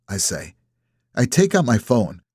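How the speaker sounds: ordinary delivery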